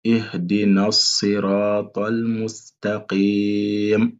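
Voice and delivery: ihdinas siratal mustaqim, très lent et lié; répéter lentement pour les élèves; récitation lente. Shown as a demonstration. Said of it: "Je répète encore". (récitation lente et liée)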